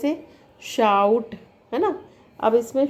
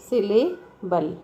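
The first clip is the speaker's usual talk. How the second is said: Syllable slower, broken as सिले, then बल; split into syllables; slower than usual. Shown as a demonstration. Teacher giving the word as an example, syllable split by a pause. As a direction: pause before the final बल in syllable. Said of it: "syllable अपने आप में ही एक, example है".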